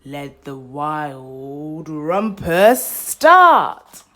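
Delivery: loud, slow, drawn-out proclamation; loud slow cry. Acted. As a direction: shout it slowly like a wild king. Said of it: "cried Max".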